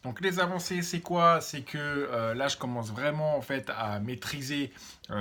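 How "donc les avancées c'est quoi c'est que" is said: ordinary talk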